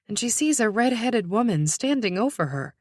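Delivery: ordinary delivery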